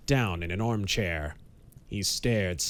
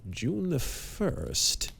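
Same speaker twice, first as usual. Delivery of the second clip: speculative; 5 semitones higher, 4 dB quieter, much slower than usual. A narrator's reading. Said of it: "said Harry speculatively".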